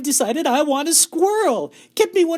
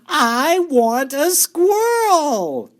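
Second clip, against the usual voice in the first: bratty shout; 12 semitones higher, 7 dB louder than usual. A narrator's reading. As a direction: whine and yell like a spoilt brat. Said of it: "shouted Veruca".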